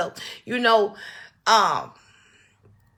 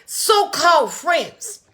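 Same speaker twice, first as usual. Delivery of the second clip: So-called slow and raised, bitter emphasis; stress on so-called; 7 semitones higher, 4 dB louder, much slower than usual. Real, not acted. Repeating it to viewers, slow scornful stress on So-called. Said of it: "Do y'all hear what I say?".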